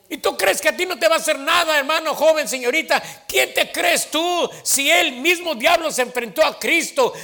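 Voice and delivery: full-force preacher shouting; fiery preaching. Acted. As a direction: thunder a warning at the congregation.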